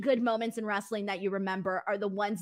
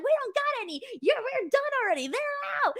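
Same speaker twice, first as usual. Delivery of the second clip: much higher, cartoonish announcer apology; mock announcer voice; 13 semitones higher, faster than usual. Announcers telling Eddie they're out of pens, mock high voice. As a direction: pitch way up like a flustered announcer. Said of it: "they were like".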